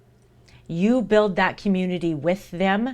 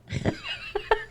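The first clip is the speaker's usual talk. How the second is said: laughing; 6 dB quieter than usual. Real, not acted. Laughing at own joke.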